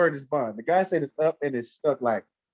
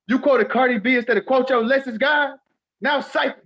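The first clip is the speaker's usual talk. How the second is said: mock scolding; 8 semitones higher, 3 dB louder than usual.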